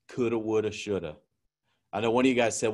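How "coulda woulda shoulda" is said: regretful sigh. Retold or imitated. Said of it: "sitting on the sidelines of torment going".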